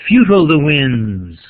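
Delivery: FUTILE hit loud on its first syllable, falling away over "the winds". Shown as a demonstration. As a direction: punch the start of "Futile", then stretch out "the winds". Scanning a poem aloud.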